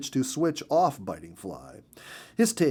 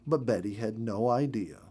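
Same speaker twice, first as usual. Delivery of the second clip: usual speaking voice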